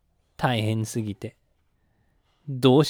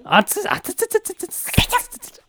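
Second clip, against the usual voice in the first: mock burning. Pretend burned by fire Pokémon.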